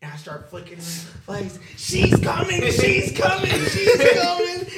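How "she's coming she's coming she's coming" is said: high, loud shrieked warning in the dark; loud teasing scream, half laughing; mock scary shout. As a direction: yell it to spook the kids upstairs. Pranking siblings by flicking lights.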